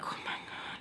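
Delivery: barely voiced, exasperated whisper; hushed, retold exasperation; fuming whisper. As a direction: whisper it in fed-up disbelief. Fuming reaction to him returning.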